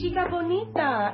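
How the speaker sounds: high, bright Spanish greeting; Spanish accent. Acted. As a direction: call out sweetly in Spanish. Maria greets Ellie warmly.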